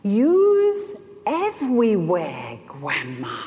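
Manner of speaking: put-on a two-year-old marveling up at his grandma; toddler's voice. Grandson marveling at grandma, in a slow small-child voice. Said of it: "and he said".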